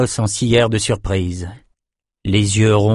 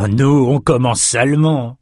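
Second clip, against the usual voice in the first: higher, taunting sneer; mocking jeer; 4 semitones higher than usual. A narrator's reading. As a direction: tease the victim with menace. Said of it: "railla Jer".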